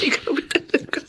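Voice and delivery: breathy, quiet chuckle into words; soft laugh. Acted. Grandmother laughing softly at her grandson's joke. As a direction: laugh fondly at his cheekiness.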